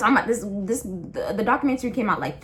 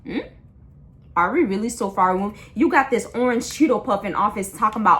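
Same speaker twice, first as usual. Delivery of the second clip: normal talking voice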